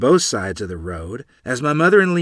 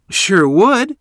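much higher, louder eager reply; excitedly; 10 semitones higher, 9 dB louder than usual. Acted. Boy agreeing to go down the well, excited. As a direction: jump at the chance. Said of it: "I replied excitedly".

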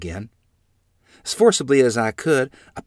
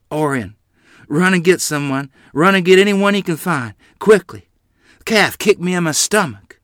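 raised, louder pained urging; moaned plea; 8 semitones higher, 7 dB louder than usual. A narrator's reading. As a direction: plead urgently, a touch of pain. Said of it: "Ma moaned".

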